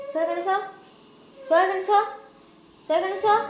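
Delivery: loud high scolding shoo; loud high shoo at the cat. Real, not acted. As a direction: push the cat away with repeated loud commands. Shooing the cat repeatedly.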